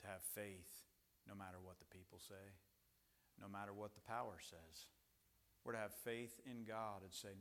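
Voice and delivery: very soft, low preaching; quietly steady declaration of faith; hushed solemn resolve. Real, not acted. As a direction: bring it right down, grave and measured.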